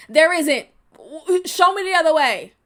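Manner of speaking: real angry challenge; loud challenge. Real, not acted. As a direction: demand an answer. Challenging the pacifist argument.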